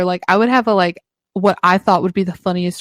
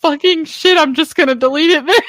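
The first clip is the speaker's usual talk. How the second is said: much higher, fast sulky outburst; mock frustration; 8 semitones higher than usual. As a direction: huff and give up. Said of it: "I'd be like".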